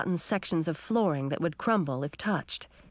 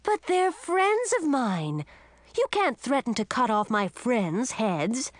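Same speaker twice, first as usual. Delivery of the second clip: much higher, pleading daughter; upset protest; 5 semitones higher than usual. Acted. Pearl pleads angrily.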